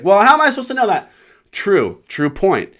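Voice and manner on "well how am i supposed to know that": high, loud, fast indignant voice; snooty girl impression. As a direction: fire it off high and huffy. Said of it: "She's like".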